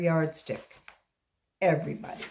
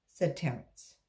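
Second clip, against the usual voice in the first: usual speaking voice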